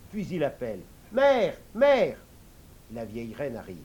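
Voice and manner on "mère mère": loud high call for mother. Acted. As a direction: call out across the palace. King calls the queen mother.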